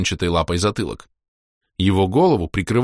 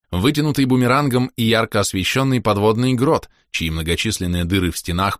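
ordinary delivery

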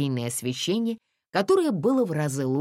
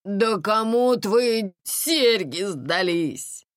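slow, raised exasperation; higher, drawn-out groaning complaint; gasped dismay; 5 semitones higher, 4 dB louder, much slower than usual. A narrator's reading. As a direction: moan in disbelief, dragging the words. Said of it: "охнула тётя Тома".

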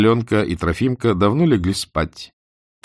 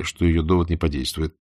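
ordinary delivery

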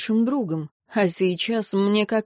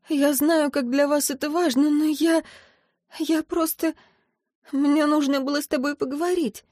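tearful pleading; 7 semitones higher than usual. A narrator's reading. Tearful apology after breakup.

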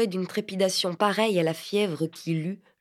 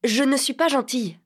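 put-on childlike; 6 semitones higher, 4 dB louder than usual. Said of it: "La phrase enfantine".

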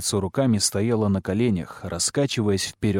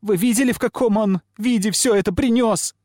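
loud, high-pitched shriek; girl's outraged squealing, high and loud; shrill squeal; 12 semitones higher, 5 dB louder than usual. A narrator's reading. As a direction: shriek the accusation at them. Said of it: "взвизгнуло пламя".

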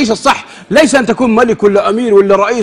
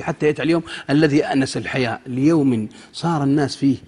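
ordinary talk, nothing marked

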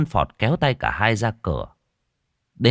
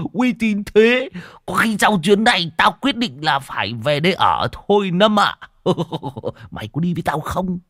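giggling lecher; 8 semitones higher than usual. Acted. Old man leering at girls from the car, high cackling glee.

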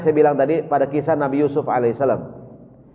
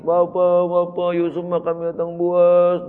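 higher, drawn-out mock wail of the brothers; brothers' fake lament; 4 semitones higher, slower than usual. Lying brothers wail to Father Yakub about Yusuf. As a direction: play the lying sons, whining to their father.

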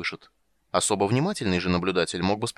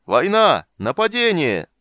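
high, loud alarm cry with glee; jubilant yell; 9 semitones higher, 8 dB louder, slower than usual. A narrator's reading. Old man rousing the dragon to war, jubilant yell. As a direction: raise the alarm triumphantly. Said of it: "ликующе завопил он прямо в ухо дракону".

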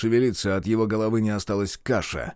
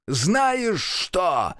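высокий вызывающий окрик; бросить вызов в темноту; крик; 9 semitones higher than usual. A narrator's reading. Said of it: "крикнул он в тёмный проём". (Мерл кричит в тёмный проём)

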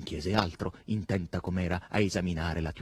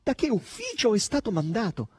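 high, pleading demand; louder, strained, high questioning; pained; 13 semitones higher, 5 dB louder than usual. A narrator's reading. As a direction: press the question in distress. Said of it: "smorfia di dolore e poi soggiunse".

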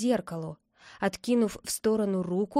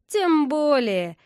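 high, slow, drawn-out spiteful jibe; snide; 5 semitones higher, 5 dB louder, slower than usual. A narrator's reading. Boy taunting his angry friend on the phone, snide. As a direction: needle him with mock sweetness. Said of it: "ехидным голосом".

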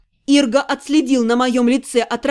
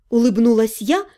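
ordinary delivery, nothing marked

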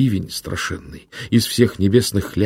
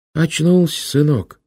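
put-on a kindly old monk, a touch higher; old monk's voice; 8 semitones higher, 3 dB louder, slower than usual. A narrator's reading. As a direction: speak as a gentle old hermit. (лёгкий голос доброго старого инока)

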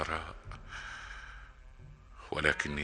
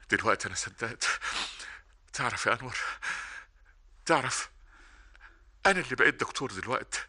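higher, rushing, emotional thanks; overjoyed; 6 semitones higher, faster than usual. Acted. Older brother pours out joyful thanks to Anwar, higher and rushing. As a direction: pour out the joy.

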